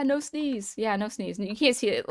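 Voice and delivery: usual speaking voice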